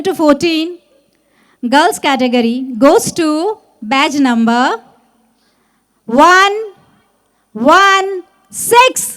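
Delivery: loud, slow drawn-out winner call; booming prize announcer. Acted. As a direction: boom out the winning number. First prize announcement, shouted.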